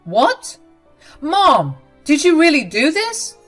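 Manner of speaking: high, loud disbelief; can't believe what she did; shocked. Acted. Husband shocked to learn what his mom did.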